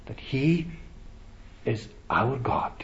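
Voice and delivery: softly breathed words of awe; hushed. Real, not acted. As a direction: drop to a whisper. Hushed climax of sermon point.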